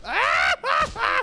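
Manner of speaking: startled shout. Real, not acted. Gamer hit mid-sentence, startled shout. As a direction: yelp in sudden shock. Sudden shout during attack.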